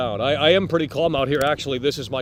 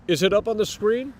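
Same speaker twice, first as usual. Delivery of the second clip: usual speaking voice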